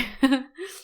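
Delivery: loud nervous giggle; embarrassed laugh. Real, not acted. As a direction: burst out laughing at herself. Laughs before starting the reading.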